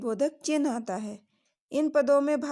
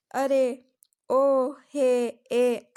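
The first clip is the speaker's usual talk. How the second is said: calling out; 6 dB louder, much slower than usual. Shown as a demonstration. Examples of calling interjections.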